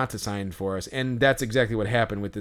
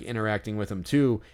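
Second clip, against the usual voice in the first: ordinary talk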